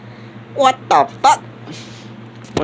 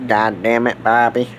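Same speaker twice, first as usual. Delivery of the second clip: deep put-on voice; 13 semitones lower than usual. Same line redone in deep voice.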